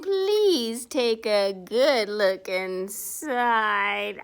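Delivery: slow, loud, mock-menacing shark. The shark luring the reader closer, slow and menacing. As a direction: draw it out like a grinning predator.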